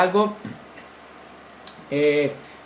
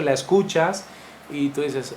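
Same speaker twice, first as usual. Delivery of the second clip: normal talking voice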